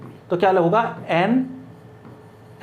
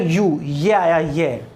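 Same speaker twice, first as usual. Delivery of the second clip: slow pointing; 5 semitones lower, much slower than usual. Shown as a demonstration. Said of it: "तो देखो पहला क्या है".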